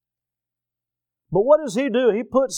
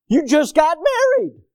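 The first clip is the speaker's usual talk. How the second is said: high, loud disbelieving outburst; shocked friend; 11 semitones higher, 5 dB louder than usual. Retold or imitated. Friend reacting to groom going fishing, shocked outburst. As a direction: blurt it out in disbelief.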